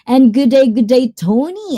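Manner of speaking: a hint of Aussie in a hearty g'day; playful Aussie greeting. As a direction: call out a playful Australian hello. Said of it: "aussie, aussie, oi, oi, oi".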